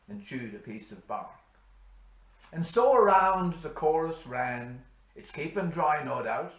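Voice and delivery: loud, high, ringing recital; bush poem recitation. Acted. Reciting Says Hanrahan for parishioners, loud declaiming. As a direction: declaim the ballad to the whole parish.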